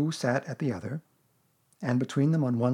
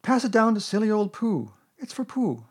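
put-on high, eager boy's voice; excited; 10 semitones higher, 6 dB louder than usual. A narrator's reading. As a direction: hand it on with delight. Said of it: "cried Christopher Robin excitedly".

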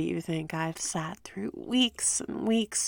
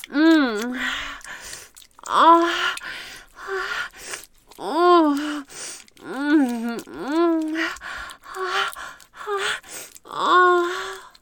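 loud moans; 8 semitones higher, 7 dB louder, much slower than usual. Acted. Climactic moaning during sex.

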